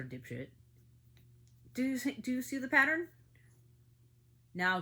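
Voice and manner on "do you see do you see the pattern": much higher and louder, demanding; near-shout of frustration; exasperated outburst. Real, not acted. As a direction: hammer the question at the audience. Angry rant over child killings.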